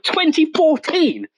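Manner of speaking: high, drawn-out disbelief; incredulous. Retold or imitated. Quotes own disbelieving reaction.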